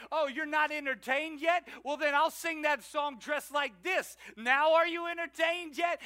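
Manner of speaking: high, loud, defiant showman; an attention-hungry performer taunting the crowd, loud and high; mock showman shout. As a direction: demand the crowd's attention, hammed up. Mock entertainer demanding attention.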